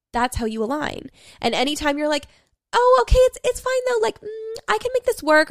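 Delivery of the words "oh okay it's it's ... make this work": self-appeasing voice. Retold or imitated. Mocking her self-appeasing inner voice.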